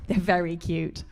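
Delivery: normal talking voice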